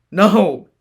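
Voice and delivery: high loud outburst; yelling. Acted. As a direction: shout her away. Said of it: "he yelled".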